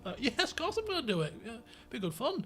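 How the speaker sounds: high, fast eager yes; mock eager reply. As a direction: chirp it out brightly, overkeen. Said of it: "We're like".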